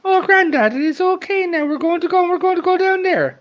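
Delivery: 할아버지를 달래는 손녀처럼 훨씬 높은 목소리로; cousin's voice. Retold or imitated. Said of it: "she's like".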